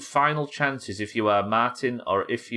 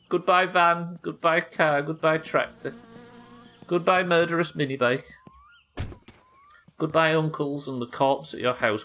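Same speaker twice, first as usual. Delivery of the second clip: mock tearful farewells; 7 semitones higher than usual. Fond farewells to game objects.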